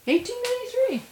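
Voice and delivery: surprised, high "Eighteen ninety-three"; rising in disbelief on the date. Real, not acted. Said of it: "I thought it was later".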